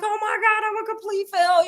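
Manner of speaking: high-pitched wail of defeat; hammed-up melodramatic self-pity; mock despair. Mock despair of failed actor.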